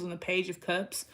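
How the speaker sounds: ordinary talk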